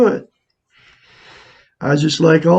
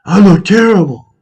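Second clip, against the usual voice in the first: louder, higher mock dismay at your own reflection; 4 semitones higher, 7 dB louder than usual. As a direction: recoil at the wrinkles in the mirror, hammed up. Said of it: "You can say".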